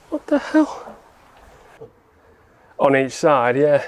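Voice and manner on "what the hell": much higher, baffled exclamation; really can't believe it now; more surprised. Real, not acted. Bolt suddenly moves unexpectedly.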